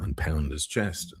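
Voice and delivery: normal talking voice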